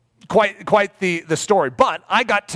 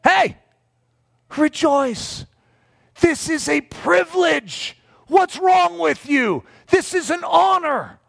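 high, slow, emphatic rebuke; 12 semitones higher, slower than usual. Retold or imitated. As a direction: scold him into rejoicing, drawn out and lifted. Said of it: "[name] sort of looks at him and goes".

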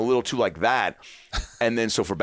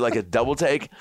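ordinary talk, nothing marked

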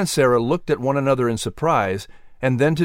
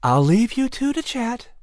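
high mother's voice, pleasant; mother leaving them to talk; Sarah; 12 semitones higher than usual. A narrator's reading. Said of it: "Sarah told them with a smile".

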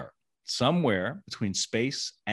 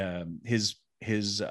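ordinary talk

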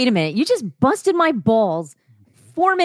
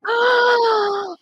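shocked gasp; 13 semitones higher than usual. Real, not acted. Shocked by erotic reading.

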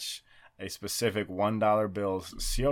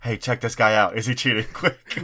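urgent host cue; 3 semitones higher, 7 dB louder than usual. Retold or imitated. Host cueing backstage people in alarm.

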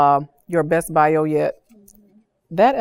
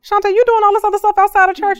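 much higher, louder and quicker, a nosy church lady; curious church member; 13 semitones higher, 6 dB louder, faster than usual. Retold or imitated. Church members asking Shantae about her outside work, in a high excited squeal. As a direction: squeal it like a surprised fellow churchgoer catching on. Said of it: "people are starting to like".

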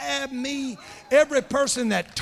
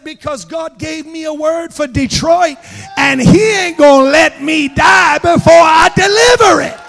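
swelling to a full preaching shout; proclaiming to the old lady, building to a shout; building preacher shout; 5 semitones higher, 15 dB louder than usual. Retold or imitated. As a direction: rise into a thunderous declaration. Preacher declares God's word to her.

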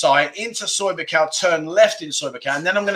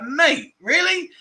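retold surprise; 11 semitones higher, much slower than usual. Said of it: "I was like".

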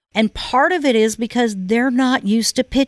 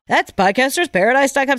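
awkward pitchman's ad line, pitched higher; a lightly mimicked, goofy radio-ad plug; imitating John Lee Dumas; 5 semitones higher, faster than usual. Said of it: "really did sound like a Saturday Night Live parody".

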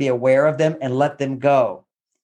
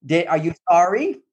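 mock nagging wife; 5 semitones higher than usual.

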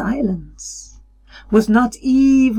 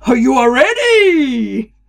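booming voice; 5 semitones higher, 4 dB louder than usual. A narrator's reading. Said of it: "A voice boomed".

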